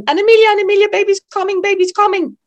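high, loud, breathless urgent calling; a panicking mother shouting down the phone; panicked phone call. Retold or imitated. Woman in labour panicking on the phone to her midwife. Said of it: "kan ikke sige ret meget andet i telefonen".

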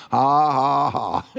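forced ha's breaking into a chuckle; Brother Hagin's defiant laugh. Retold or imitated. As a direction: mimic a triumphant laugh at the devil. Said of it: "I can still hear his voice".

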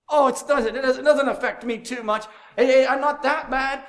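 a light mock shrug in the voice; mock dismissive sinner.

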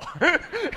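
real laugh at his own punchline; preacher cracking up; high burst of laughter. Real, not acted.

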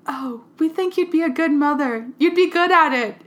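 high, lightly voiced doting parent; sweet parent voice. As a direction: coo it like a proud parent.

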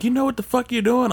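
mock stern cop; angry cop. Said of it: "He's like".

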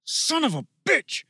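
high, furious battle curse; angry yell. A narrator's reading. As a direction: yell the curse in pain and rage. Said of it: "he yelled".

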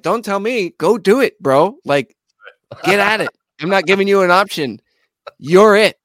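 insistent. Retold or imitated. Bandleader tells drummer to get at it, insistent. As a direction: cut off the excuses in a mock pep talk.

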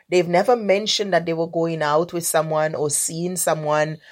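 ordinary talk, nothing marked

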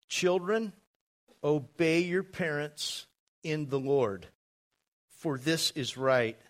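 slow, measured Scripture reading; deliberate reading with long pauses. Real, not acted. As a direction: read it out slowly, pausing between phrases. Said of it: "I'm gonna read them slowly".

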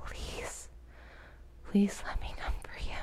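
desperate breathy begging; pleading whisper. Acted. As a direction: beg in a whisper. Begging lover, whispered plea.

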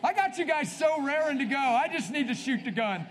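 loud, high rallying cry; hype call. Real, not acted. Preacher pumping up the congregation before prayer, loud and high. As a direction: fire up the crowd like a starter's gun.

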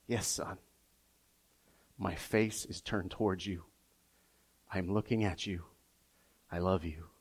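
hushed fatherly comfort; tender father. Retold or imitated. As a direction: soothe a child to sleep in the dark. Father voiced softly in story.

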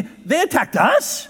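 "they" lifted high, drawn out, questioning rise; stress on they. Shown as a demonstration. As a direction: lean hard on "they", slow and puzzled. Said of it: "you could put an emphasis on any".

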